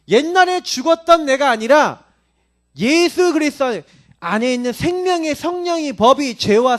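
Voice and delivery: high, loud proclamation with stretched words; emphatic preaching. Acted. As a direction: ring it out to the congregation as a declaration.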